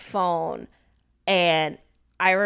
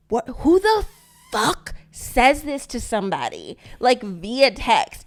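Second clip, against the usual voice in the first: higher, louder incredulous outburst; retold outrage; 4 semitones higher, 5 dB louder, slower than usual. Wife retelling her fury at a breakup text, incredulous and loud. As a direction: punch it out in disbelief. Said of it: "I was so mad".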